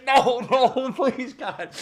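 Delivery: high, loud laughing plea; mock pleading. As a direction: beg in hammed-up panic, laughing. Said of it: "I said".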